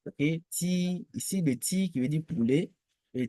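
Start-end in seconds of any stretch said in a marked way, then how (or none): 0.5-1.1 "ti" high, long and level; sustained, unbending high "ti"; first tone. Shown as a demonstration. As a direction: hold "ti" on one high note. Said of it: "le ton".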